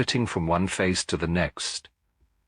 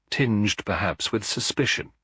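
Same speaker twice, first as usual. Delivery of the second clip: plain reading voice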